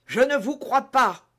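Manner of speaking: high, loud last gasp of defiance; agonised disbelief. A narrator's reading. Dying soldier rejects D'Artagnan's oath, in agony. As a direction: refuse his oath with dying effort.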